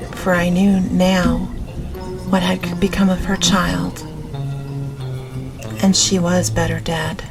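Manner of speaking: usual speaking voice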